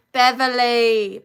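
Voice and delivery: loud, drawn-out call of the name Beverly; Beverly stretched slow and loud, like hailing a friend. Real, not acted. Host calls out to a viewer, loud and drawn out.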